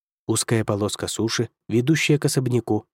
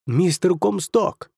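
higher, slow glad exclamation; exclaiming; 7 semitones higher, slower than usual. A narrator's reading. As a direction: call his name with delight. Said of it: "воскликнул он".

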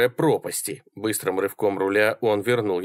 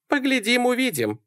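high-pitched voicing of a woman; high female character voice; Sally's voice. A narrator's reading. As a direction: lift it high, noncommittal. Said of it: "сказала Салли".

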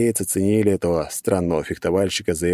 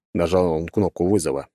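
ordinary delivery, nothing marked

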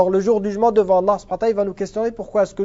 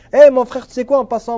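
louder, higher mock call to a brother; casual confider; 4 semitones higher, 6 dB louder than usual. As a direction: hail a friend to casually confess, acted out. Acting out casually confessing to a brother.

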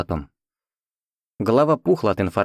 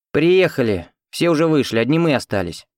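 annoyed woman; 6 semitones higher than usual. Acted. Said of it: "Девица явно была недовольна".

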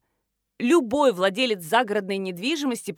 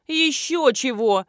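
slow, higher indignant retort; mild anger; 4 semitones higher, 3 dB louder, slower than usual. A narrator's reading. Marina refusing to put the pig back, mild anger. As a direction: refuse crossly, dragging out the words. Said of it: "рассердилась блогер-миллионщица".

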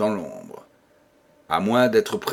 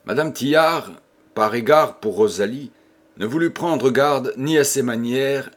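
ordinary delivery, nothing marked

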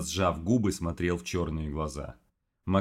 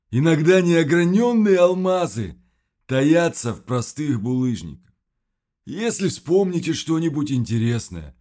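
higher, louder otherworldly creature; monster voice; 8 semitones higher, 8 dB louder than usual. Acted. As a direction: lure them like a monster.

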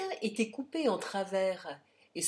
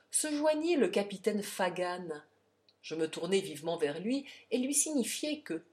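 usual speaking voice

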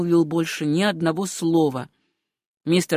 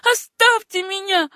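high desperate plea, louder; pleading; 11 semitones higher, 4 dB louder than usual. A narrator's reading. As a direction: beg to be left alone. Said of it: "умоляющим голосом промолвила девушка". (умоляющим высоким голосом)

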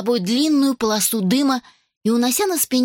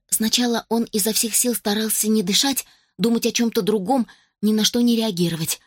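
ordinary delivery, nothing marked

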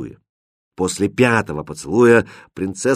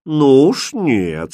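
firm refusal; 4 semitones higher, 4 dB louder, much slower than usual. A narrator's reading. Character firmly refuses the princess.

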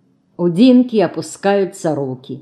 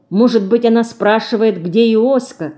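plain reading voice

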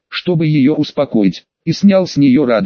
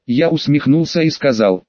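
plain reading voice

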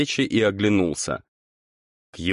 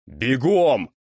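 growled command; 5 semitones higher than usual. A narrator's reading. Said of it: "рыкнул парень".

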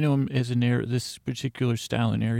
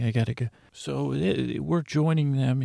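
usual speaking voice

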